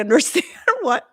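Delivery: ordinary talk, nothing marked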